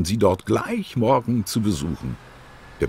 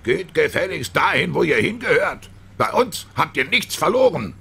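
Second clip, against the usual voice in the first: angry shout; 10 semitones higher than usual. A narrator's reading. Man on the bus yells at two foreign children, angry shout. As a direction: bark it at the kids like an enraged stranger. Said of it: "Der sprang auf und schrie".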